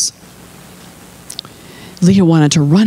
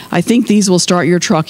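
ordinary delivery

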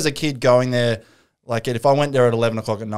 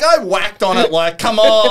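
mock drunk excuse; 8 semitones higher, 6 dB louder, slower than usual. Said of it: "And he's like".

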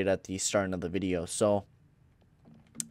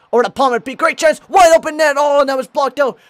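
much higher, louder play-by-play burst; excited call; 13 dB louder, faster than usual. Real, not acted. Commentator yelling as the net opens up, excited. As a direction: shout the big chance. Near goal chance.

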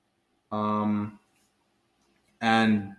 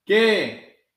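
loud high startled qué; startled answer; 9 semitones higher, 6 dB louder than usual. A narrator's reading. Froggy answers the coach, loud startled shout. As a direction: shout back, caught off guard.

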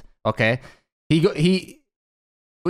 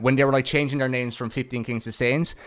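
ordinary talk

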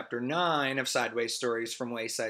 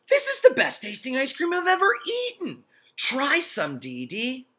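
put-on excited boy voice; 13 semitones higher, 7 dB louder than usual. Todd voiced in story.

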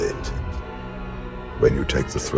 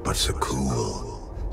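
slow, near-whispered, softer; hushed reverence; 5 dB quieter, slower than usual. Acted. Fallen Sith emperor recalls the world he chose, hushed. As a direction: let the name fall away quietly. Old emperor reminisces about Zakuul.

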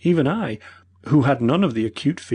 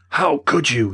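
reproachful cry; 5 semitones higher, 5 dB louder, slower than usual. A narrator's reading. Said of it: "he cried".

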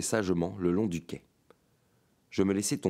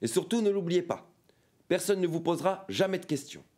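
much higher, insistent cry; higher, insistent cry to close the deal; 11 semitones higher than usual. A narrator's reading. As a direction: press the point by crying out. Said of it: "il cria".

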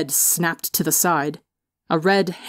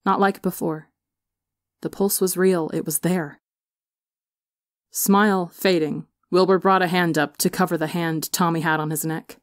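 plain reading voice

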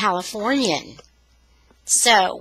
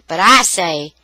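ordinary delivery, nothing marked

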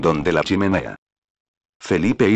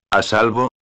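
with a singular smile; 2 semitones higher than usual. A narrator's reading. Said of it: "repuso el conde con una singular sonrisa".

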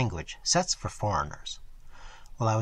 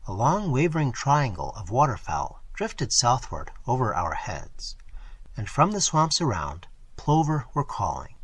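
ordinary delivery, nothing marked

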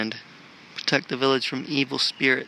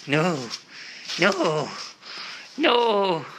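high pleading no; 4 semitones higher, 3 dB quieter, much slower than usual. Real, not acted. A walker begs a leaving cat in a high voice. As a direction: beg the cat not to go, high.

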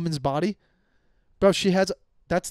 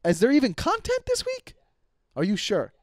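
high-pitched, incredulous questioning; exasperated; 6 semitones higher than usual. Real, not acted. Host needling the crew as the show stalls, exasperated. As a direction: push the pitch up in exasperated disbelief.

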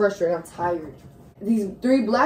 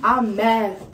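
ordinary talk, nothing marked

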